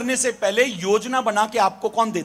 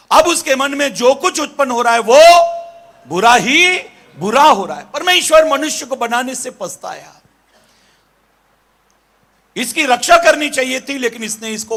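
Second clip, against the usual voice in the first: loud, raised sermon voice; forceful preaching; 3 semitones higher, 9 dB louder than usual. Real, not acted. Preacher tells congregation of man's corrupted heart, forceful preaching. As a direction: preach it out with heavy punch.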